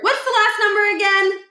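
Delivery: put-on a light high goofy boy voice; lightly voiced dopey kid; Stuart voice. Stuart asks Miles the combination, high and goofy. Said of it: "said Stuart".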